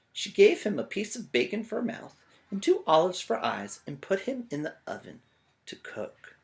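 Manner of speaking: lively read-aloud for a class; storybook narration. A narrator's reading. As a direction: tell it like a picture book to kids. Reading picture book aloud.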